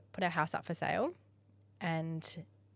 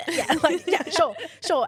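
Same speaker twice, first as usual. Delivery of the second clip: much higher and louder, laughing yes; 11 semitones higher, 11 dB louder than usual. Retold or imitated. Accepting the daycare spot on the phone, laughing much higher and louder. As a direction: blurt out yes through giddy laughter.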